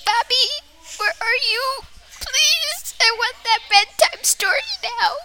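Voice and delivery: put-on high, pleading little-kid voice; high whiny pleading child; scared child calling. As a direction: call out high and scared for the bedtime story.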